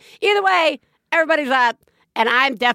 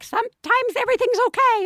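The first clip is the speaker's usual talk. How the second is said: high mock voice; 14 semitones higher, faster than usual. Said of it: "people are like".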